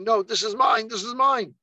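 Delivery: high-pitched imitation of a possessive boy; retold frantic protest. Traumatised boy to army captain, high frantic protest. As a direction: act out a child's urgent, high protest.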